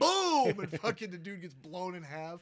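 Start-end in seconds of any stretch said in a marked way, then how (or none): 0.0-0.6 high loud mock explosion; shouted boom. Retelling the bug blown in half, loud shouted boom. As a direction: blast it out like an explosion.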